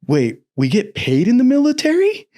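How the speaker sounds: high, incredulous squeak of surprise; naive surprise. Retold or imitated. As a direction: gasp it out like you just learned soldiers get paid. Retelling naive recruit surprise.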